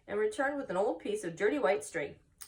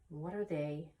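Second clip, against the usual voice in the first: sad; 3 semitones lower, 7 dB quieter than usual. Acted. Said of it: "asked Wilbur sadly".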